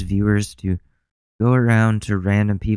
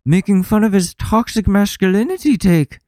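high-pitched, louder mocking impression; Jordan Peterson; 11 semitones higher, 5 dB louder than usual. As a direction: push the voice up high to lampoon him. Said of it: "my Jordan Peterson impression".

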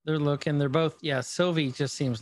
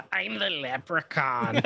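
slow, hammed-up spooky leprechaun line; mock leprechaun; slower than usual. Pretending to scare a sleeping child, slow mock spooky voice. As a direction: drag it out to scare a sleeping kid.

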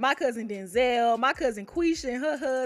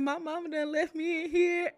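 mock whimper; 5 semitones higher than usual. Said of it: "I was in there boo-hooing".

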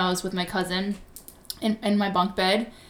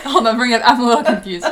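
loud, higher, laughing interruption; laughing confusion; 5 semitones higher, 10 dB louder, faster than usual. Real, not acted. Listener lost in the glitch explanation, laughing confusion. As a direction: break in loudly through a real laugh.

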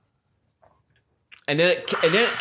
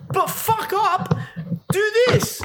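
squeaky mock order. Mocking the chief snapping an order in a squeaky voice. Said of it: "he goes".

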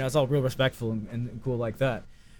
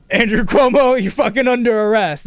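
loud, high arrest announcement; full-voiced mock police command; police shout; 8 semitones higher, 8 dB louder than usual. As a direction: shout it like a cop storming in. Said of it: "And the police are like".

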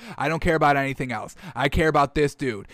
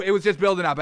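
ordinary delivery

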